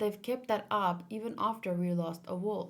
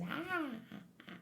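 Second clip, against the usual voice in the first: imitating his talking; 8 semitones higher, 8 dB quieter, much faster than usual. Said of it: "why is he talking like this".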